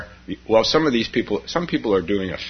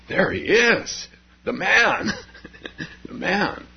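starstruck praise; 10 semitones higher, slower than usual. Acted. Meditators hail the Buddha.

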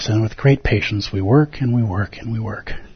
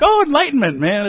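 dreamy awe. Pretend. Teacher mocks students' dreamy hopes, in a high, gushing voice. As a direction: gush about enlightenment like a starry-eyed seeker. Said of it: "You're like".

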